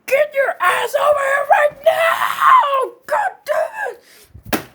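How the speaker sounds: very high, loud, slow screaming; furious screaming. Acted. Enraged man screaming at the friend who spent his money, slow and high. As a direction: scream him over here.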